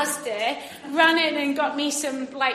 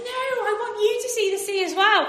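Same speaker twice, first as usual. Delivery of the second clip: high, fast eager plea; urging a scared friend to jump; eager coaxing; 8 semitones higher, 4 dB louder, much faster than usual. Retold or imitated. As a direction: coax her on brightly and quickly. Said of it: "I was like".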